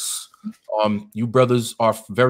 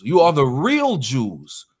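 real stretched and weighted, slow; slow insistent stress on real; 2 semitones higher, slower than usual. Real, not acted. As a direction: lean hard on the word real. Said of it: "with an emphasis on real".

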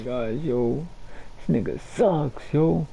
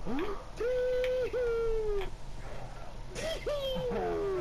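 sharp gasp; 13 dB quieter than usual. Real, not acted. Shocked by a play.

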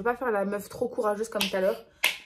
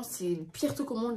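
normal talking voice

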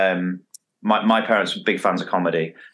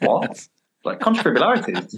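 a hint of baffled surprise, higher; baffled what; 8 semitones higher than usual. Doctor Johnson baffled by the made-up word, mock confused. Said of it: "And he's like".

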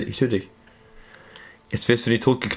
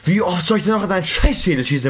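exasperated rant; 8 semitones higher than usual. Real, not acted. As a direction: vent at the enemy who won't die. Raging at unkillable enemy.

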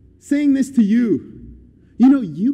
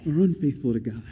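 soft, halting indecision; imitating someone who can't decide; wavering back and forth; 2 semitones lower, 6 dB quieter than usual. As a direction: weigh it up as if torn. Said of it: "back and forth".

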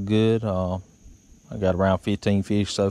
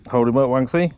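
raised voice carried across the water; calling out; 4 semitones higher, 4 dB louder, faster than usual. Real, not acted. To Daddy fishing nearby, calling out across the water. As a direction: call it over to the other boat.

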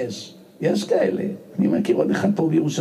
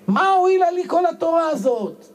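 angry shout; 12 semitones higher than usual. Retold or imitated. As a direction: yell it as a furious young scholar. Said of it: "צועק".

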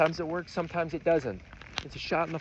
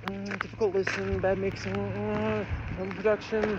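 higher, droning mock hater; mock grumbling critic; 3 semitones higher than usual. Vlogger mimicking haters of difficult music, mock grumbling. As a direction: grumble through complaints in a higher put-on voice.

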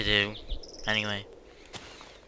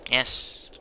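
normal talking voice

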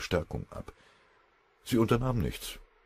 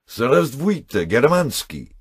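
loud, high called-out greeting; cheerful shout; 9 semitones higher, 9 dB louder, much slower than usual. A narrator's reading. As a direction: holler a jovial hello across the street. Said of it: "lachte und schrie". (fröhlich herübergerufen)

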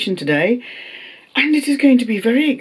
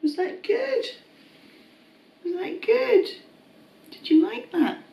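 much higher, soft pet-talk voice; 12 semitones higher, 5 dB quieter than usual. Real, not acted. Owner cooing to the pets after the treat. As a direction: coo the question up high to the pets.

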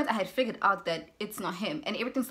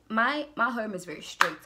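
usual speaking voice

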